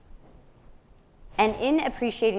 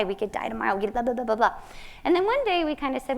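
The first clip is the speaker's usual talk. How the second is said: ordinary talk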